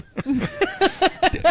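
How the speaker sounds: sudden snorting laugh. Real, not acted. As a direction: laugh with a snort through the nose. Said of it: "Oh, [name] snort laughed".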